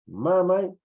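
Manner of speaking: "Mamay" high and loud, held firmly; contrasted possessive word. Shown as a demonstration. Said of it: "es mi".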